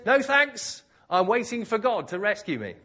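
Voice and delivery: high, loud acted refusal; insistent holler, turning down help; called-out refusal. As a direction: call back firmly from the rooftop. Stranded believer refusing rescue again.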